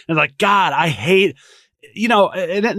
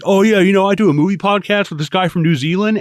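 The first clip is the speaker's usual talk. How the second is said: loud, high, smug know-it-all; smug manager voice; 6 semitones higher, 5 dB louder than usual. Retold or imitated. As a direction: butt in like a pompous boss. Imitating asshole manager.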